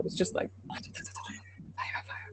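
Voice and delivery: hushed, voiceless echo; playful whisper. Pretend. Coach joking to colleague about mixed metaphors, playful whisper. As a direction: whisper it as a joke aside.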